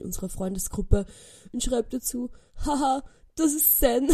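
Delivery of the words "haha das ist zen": laughing caption. Retold or imitated. Quoting her own meme caption.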